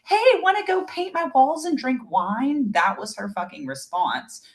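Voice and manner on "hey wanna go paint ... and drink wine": high, loud sing-song mockery of the neighbor; high bubbly mock of the careless neighbor. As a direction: send up her breezy invite. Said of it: "then was like".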